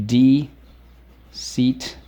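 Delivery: stretched-out deceitful, each syllable drawn long; slow, careful teacher model of Deceitful. Shown as a demonstration. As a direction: sound out Deceitful slowly for the class. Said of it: "Let's go".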